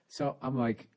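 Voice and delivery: ordinary talk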